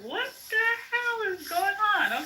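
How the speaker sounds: high, shocked outburst. Real, not acted. Hearing friends trade insults, a high shocked outburst. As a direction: react in disbelief.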